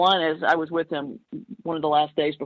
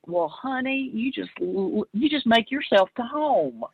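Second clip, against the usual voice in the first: imitating Dad's thick Southern drawl, pitched up; higher-pitched, hammed-up Southern accent; 5 semitones higher than usual. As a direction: put on a thick Southern accent for a retold line. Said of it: "in a thick Southern accent say".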